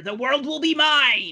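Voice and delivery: lightly hammed evil mastermind; Cobra Commander. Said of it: "channel your inner Cobra".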